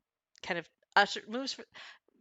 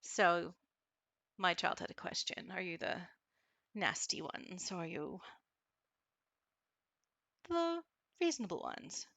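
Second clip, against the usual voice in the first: wary mother cat; 7 dB quieter than usual. Acted. Mother cat questioning the visitors, soft and wary. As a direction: probe the strangers quietly.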